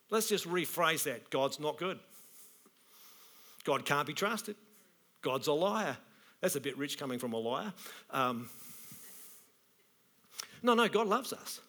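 normal talking voice

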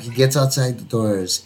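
usual speaking voice

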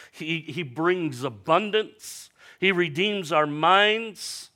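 slow, loud, lifted preacher cadence; building sermon declaration; preaching at full force. Acted. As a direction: proclaim each line like a promise. Preaching crescendo list.

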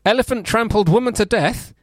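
high-pitched headline announcement; headline read. Acted. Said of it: "I wanna read the whole headline".

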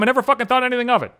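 usual speaking voice

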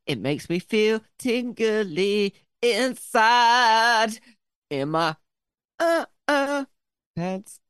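high, slow, drawn-out silly voice; hammed-up tingly delight. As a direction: stretch it out with comic squirming pleasure. Hammed-up answer about tingly feeling.

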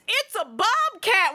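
high, slow, stretched yell of bobcat; mock scream. Retelling the man yelling while holding the bobcat, high drawn-out shout. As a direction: imitate a stunned man shouting. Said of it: "he screams".

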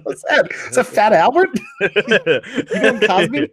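cracking up at a friend's bad impression; laughing. Real, not acted. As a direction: laugh while asking what he's doing. Teasing partner's bad impression.

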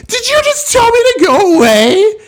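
high, loud mock shriek; imitating an indignant drunk's screech; mock outraged yell. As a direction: shriek it like an offended drunk, hammed-up. Retelling drunk woman's outraged reply.

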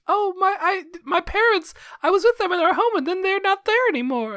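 put-on very high, trembling child voice; upset orphan. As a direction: squeak it out like a lost kid. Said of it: "they're just like".

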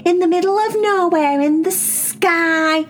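Vaya voice. Acted. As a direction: gaze at glowing cats in awe.

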